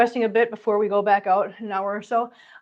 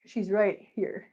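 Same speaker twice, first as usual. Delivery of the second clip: normal talking voice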